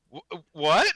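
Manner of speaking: high, loud stunned what; shocked what. Retold or imitated. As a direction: blurt it out in disbelief. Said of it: "everyone at home going like".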